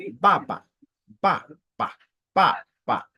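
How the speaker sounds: BA punched with a falling tone; separate, emphatic falling BA syllables; fourth-tone demo. Shown as a demonstration. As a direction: drop sharply on each BA. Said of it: "qui est le quatrième ton".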